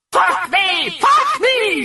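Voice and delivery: very high obscene scream; shrieking at the doctors. Acted. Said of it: "she screamed at the doctors".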